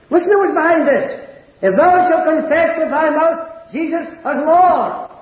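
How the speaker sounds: high, slow, ringing pulpit voice, every word of the verse held out; preaching at full force. Acted. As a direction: cry the verse out over the congregation. Preacher lifts voice for scripture.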